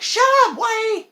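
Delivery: very high, loud retold outburst; mock shouting. Imitating other moviegoers telling him off, mock yell. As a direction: yell it in an exaggerated squawk.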